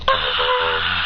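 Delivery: long low creaky "ah"; drawn-out groan. Acted. Student dismayed by the team list, drawn-out creaky groan. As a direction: let out a long rough groan.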